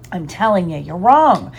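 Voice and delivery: ordinary delivery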